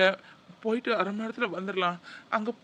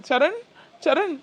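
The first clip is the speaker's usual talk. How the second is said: anxious calling; 7 semitones higher, 5 dB louder than usual. Acted. Trying to rouse sick child.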